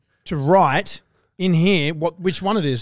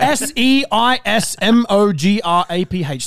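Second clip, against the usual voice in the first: slow spelling out; 3 semitones higher, slower than usual. Shown as a demonstration. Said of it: "Let me spell it out for you".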